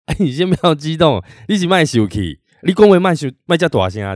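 mock scolding. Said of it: "对方却要他们".

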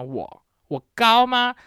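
repeating it slowly in disbelief; indignant repeat. Real, not acted. Vlogger asks viewers whether she is tall, indignant.

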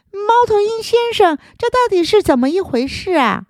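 high, puzzled little frog. Acted. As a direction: ask like a bewildered small frog.

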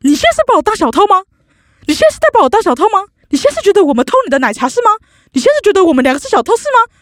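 high, fast indignant accusation; angry retort. Retold or imitated. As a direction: fire back at her, offended and shrill-pitched. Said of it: "又给我生气".

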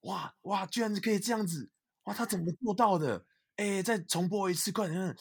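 high, loud amazed cries; amazed kids watching. Retold or imitated. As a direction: gasp and gush like a wowed teenager. Friends amazed watching a basketball video.

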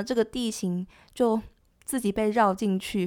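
usual speaking voice

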